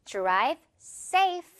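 usual speaking voice

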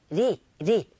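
"리" as a quick R-like tap, repeated; flap sound alone. Shown as a demonstration. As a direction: say 리 short and isolated, tongue flicked. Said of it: "이런 소리로 들린다는 거". ('리'를 짧게 굴려서)

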